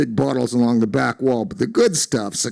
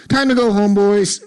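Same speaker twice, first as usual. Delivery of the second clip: high, loud closing-time call; firm declaration; 7 semitones higher, 4 dB louder than usual. A narrator's reading. Waitress sending the drunk regulars home, loud call. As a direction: announce it to the whole room. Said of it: "she declared".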